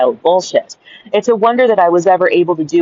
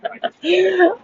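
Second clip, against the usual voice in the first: high, quieter trailing giggle; high laugh; 13 semitones higher, 4 dB quieter than usual. Real, not acted. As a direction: let the laughing fit run on. Still laughing at joke.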